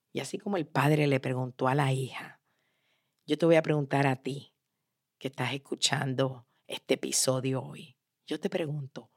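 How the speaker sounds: low, soft, intimate appeal; near-whispered earnest question; hushed direct address. Real, not acted. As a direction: drop the voice and speak straight to the listener. Storyteller turns intimately to the listener.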